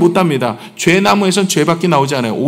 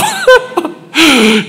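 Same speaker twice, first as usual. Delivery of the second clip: higher falling laugh; laughing at himself, higher and falling; amused laugh; 12 semitones higher, 4 dB louder than usual. Real, not acted. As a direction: laugh at your own rising temper. Laughs at anger rising inside.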